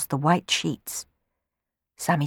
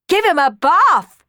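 loud, higher, drawn-out yell; children yelling excitedly to wash the sweep; 12 semitones higher, 8 dB louder, slower than usual. Acted. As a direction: shout it out eagerly. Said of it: "yelled Hughie and Tina".

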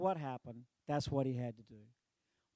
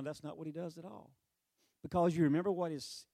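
ordinary delivery

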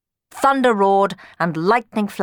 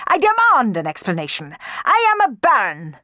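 indignant baron, climbing high and loud; pompous, furious demand; 5 semitones higher, 4 dB louder than usual. A narrator's reading. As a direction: bluster with rising outrage. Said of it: "Don Magnifico was furious".

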